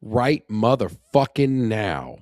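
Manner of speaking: slow, loud stress on every word of "Right motherfucking now"; driven emphasis. Real, not acted. Host answering when to plant a tree, slow emphatic "Right motherfucking now". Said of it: "uh, emphasis".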